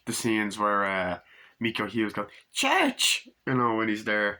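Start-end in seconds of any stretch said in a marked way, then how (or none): 2.5-3.3 high mock little-boy call; child calling cat. Little boy calling his cat Church, high mock child voice. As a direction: imitate a kid calling for his pet.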